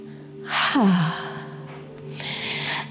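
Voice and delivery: long breathy exhale sigh; audible exhale. Shown as a demonstration. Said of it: "you can exhale through your nose or your mouth".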